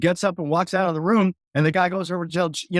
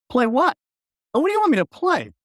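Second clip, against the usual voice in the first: baffled; 9 semitones higher than usual. Retold or imitated. Confused reply to Sly's crew.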